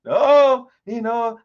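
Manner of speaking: loud, high, drawn-out sarcastic "oh"; exaggerated sarcastic imitation; mock sarcasm. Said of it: "trying to be sarcastic and say".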